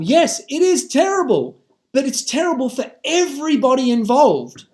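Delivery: real impassioned ranting; heated, emphatic plea to be heard; fired up. Real, not acted. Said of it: "so a bit fired up".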